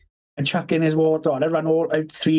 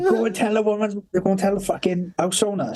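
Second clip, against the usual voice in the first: higher-pitched, mock-panicked instruction; urgent order; 6 semitones higher than usual. Retold order as the shed burns, urgent. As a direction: send someone off for help.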